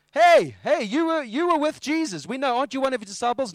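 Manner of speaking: high, loud calling-out; loud accusation. Acted. Bystander accusing Peter of being a disciple, loud challenge. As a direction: shout it like you've just caught someone.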